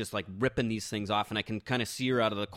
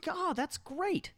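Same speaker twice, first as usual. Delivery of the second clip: high-pitched, hammed-up delight; mock-enthusiastic reply; 13 semitones higher, slower than usual. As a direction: gush in a put-on high voice, plugging the show. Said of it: "You go".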